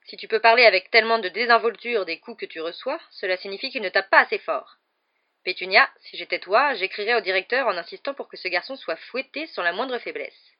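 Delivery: loud, forceful scolding; booming, severe tirade; indignant aunt. A narrator's reading. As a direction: demand stricter discipline. Aunt demanding harsher punishment.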